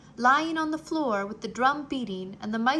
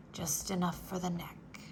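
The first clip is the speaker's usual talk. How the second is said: soft, lower mumble to himself; muttered; 5 semitones lower, 7 dB quieter than usual. Acted. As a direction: mutter it under your breath. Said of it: "muttered the mouse".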